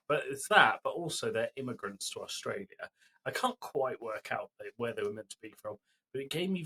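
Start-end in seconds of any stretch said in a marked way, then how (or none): none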